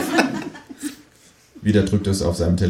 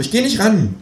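kangaroo voice; 13 semitones higher than usual. Acted. Känguru voiced in read story.

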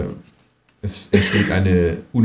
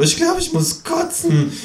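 much higher queasy kangaroo. A narrator's reading. Kangaroo about to throw up, high-pitched. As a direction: squeak out the nausea.

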